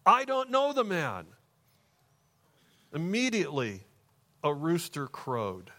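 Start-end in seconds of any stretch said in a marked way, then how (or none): 0.0-1.3 high-pitched, emphatic acted denial; acted outburst of denial; vehement sworn denial. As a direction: swear it indignantly, pitch jumping up then falling. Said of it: "he curses on himself and swore to them".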